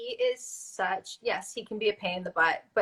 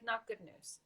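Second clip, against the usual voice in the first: quiet grim news; 2 semitones higher, 13 dB quieter than usual. Retold or imitated. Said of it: "And he was like".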